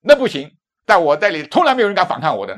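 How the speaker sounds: loud, high, domineering shout; arrogant young cop. Retold or imitated. Young officer refuses the preacher, loud and arrogant. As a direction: lord it over him, loudly. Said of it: "那个二十来岁年轻人就是这样说话的".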